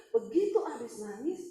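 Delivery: normal talking voice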